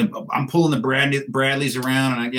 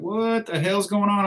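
confused soldiers; 10 semitones higher, slower than usual. Retold or imitated. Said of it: "They're like".

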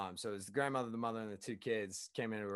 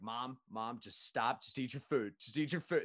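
ordinary talk, nothing marked

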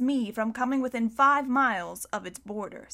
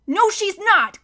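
high, loud, eager contradiction; excited; 9 semitones higher, 8 dB louder, slower than usual. A narrator's reading. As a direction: jump in eagerly to defend your sister. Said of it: "Klaus said excitedly".

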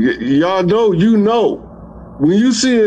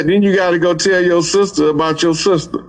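ordinary talk, nothing marked

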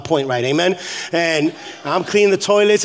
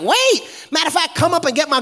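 high-pitched call to a driver; mock high holler; imitated calling out; 7 semitones higher than usual. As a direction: call out like a church lady, pitched up. Church lady calling to van driver.

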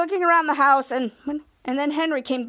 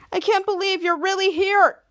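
high-pitched starstruck gushing; thrilled, raised-pitch fan voice; excited; 6 semitones higher than usual. Acted. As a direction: gush like an excited fan meeting a hero. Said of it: "was so excited".

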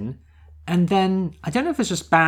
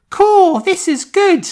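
put-on high, slow and loud boyish British voice; squeaky little English boy; high Luke voice; 7 dB louder, slower than usual. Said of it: "the weird British accents threw me because Luke goes".

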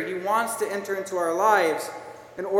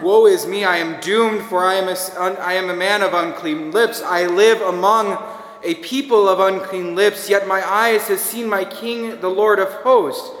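forceful scripture recitation; 6 dB louder than usual. Acted. Reads Isaiah's words with force.

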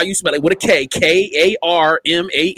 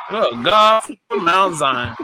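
preacher exclamation; much slower than usual. Acted. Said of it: "Hand clap her one more time".

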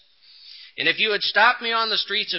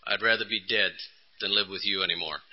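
hopeless; 7 semitones lower, 6 dB quieter than usual. Retold or imitated. Wife telling husband she'd rather die, fading to a hush. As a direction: lower and much softer, fading hopelessness. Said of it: "the only lady ... said to me".

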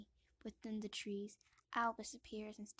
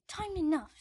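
higher, loud curt aunt; Aunt Petunia; 7 semitones higher, 13 dB louder than usual. Acted. Aunt answering the boy, loud and curt. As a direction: snap it back coldly. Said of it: "says Aunt Petunia".

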